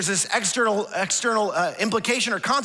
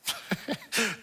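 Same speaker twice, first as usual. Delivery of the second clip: real laugh; 8 dB quieter than usual. Real, not acted. Laughs at son's reply.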